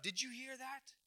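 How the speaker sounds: high-pitched outraged cry, easing down; mock shout that settles toward normal; acted shouting out. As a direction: imitate a priest appealing to the crowd, starting high. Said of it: "And he shouts out".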